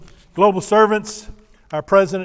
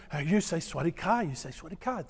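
much softer, drilling Sawasdee Ka; drilling the phrase; 2 semitones lower, 11 dB quieter, faster than usual. Retold or imitated. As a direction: coach the words quietly, over and over. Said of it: "She drilled that in my head".

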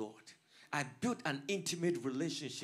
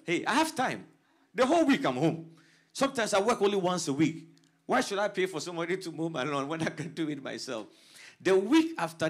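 loud preaching; 8 dB louder than usual. Real, not acted. Retelling his reply, preaching loudly.

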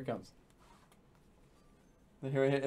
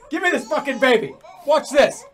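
loud, higher, bossy demand; impatient takeover; 6 semitones higher, 6 dB louder than usual. Pretend. Player snatching the pretend baby to show how, loud impatience. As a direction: demand it loudly, ready to show off.

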